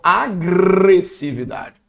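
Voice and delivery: long rolled R in "agressividade"; slow down and trill the "gr" of "agressividade"; "agressividade" stretched on "grrre"; exaggerated G-R cluster. Shown as a demonstration. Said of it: "encontro consonantal".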